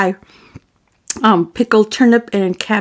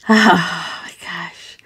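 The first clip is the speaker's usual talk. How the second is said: whispered sigh; 4 semitones lower, much slower than usual. Real, not acted. Overwhelmed by names to read.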